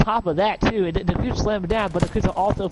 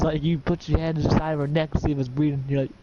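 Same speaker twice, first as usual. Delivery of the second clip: ordinary talk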